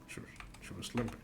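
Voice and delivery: fast whispered repeat; stunned whisper. Real, not acted. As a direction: hush it in disbelief. Repeating line in shock.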